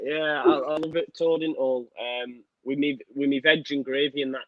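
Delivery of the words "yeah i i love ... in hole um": usual speaking voice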